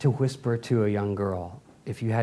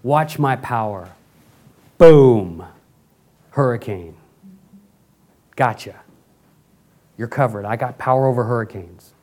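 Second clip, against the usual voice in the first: booming mock God voice, bursting loud on the big word; mock-mighty God, then casually reassuring; God showing off his power; 2 semitones lower, 8 dB louder than usual. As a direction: flaunt your power with an explosive boom. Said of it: "time for him to say".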